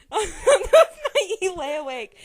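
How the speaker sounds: laughing while reading. Real, not acted. Reader still laughing after her friend's joke. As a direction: push words through laughter.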